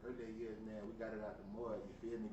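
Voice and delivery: usual speaking voice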